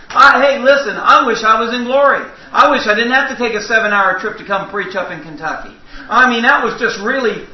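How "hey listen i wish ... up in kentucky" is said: ordinary talk, nothing marked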